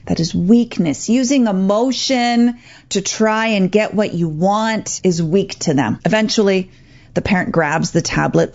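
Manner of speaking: normal talking voice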